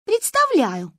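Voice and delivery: a hint of sleepy grumble; grumbling. A narrator's reading. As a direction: grumble back, annoyed. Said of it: "проворчала Манька".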